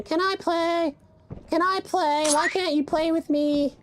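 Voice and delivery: put-on high-pitched pestering little kid voice; squeaky mock whine, drawn out at the end; begging kid. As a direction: mimic a kid pleading to join in. Mimics kids begging to join.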